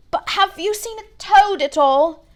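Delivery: put-on a tearful boy begging for help. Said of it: "He looked tearful".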